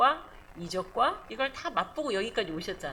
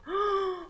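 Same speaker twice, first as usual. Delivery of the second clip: awed gasp; 9 semitones higher than usual. Acted. Gasp before praising God.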